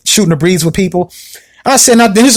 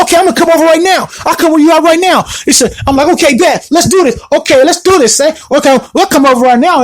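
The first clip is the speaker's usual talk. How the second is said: hyped-up challenge; 8 semitones higher than usual. Retold or imitated. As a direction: fire back, ready to fight. Accepting fight challenge, hyped.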